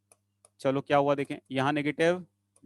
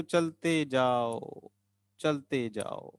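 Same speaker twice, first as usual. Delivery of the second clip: slow singsong urging, fading softer; drawn-out coaxing; 3 semitones lower, 5 dB quieter, much slower than usual. Real, not acted. Teacher coaxing students along the structure, drawn-out and fading. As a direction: stretch the words out, trailing away softly.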